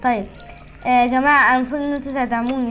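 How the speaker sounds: usual speaking voice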